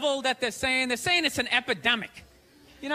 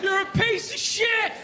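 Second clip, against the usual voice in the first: put-on drunk heckler; 8 semitones higher, slower than usual. Drunk fan in the stands, jeering. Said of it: "you're like".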